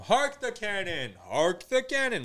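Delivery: high, drawn-out theatrical line reading; weird practice voice. Retold or imitated. Amateur actor rehearsing his one line, weird voice. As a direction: stretch HARK THE CANNON out high and silly. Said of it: "like, weird ways of saying it".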